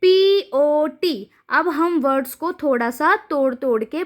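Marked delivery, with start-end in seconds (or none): 0.0-1.3 very slow, letter by letter; slow teacher spelling-out. Shown as a demonstration. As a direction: spell each letter apart for a child. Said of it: "यहाँ देखिए अब आप".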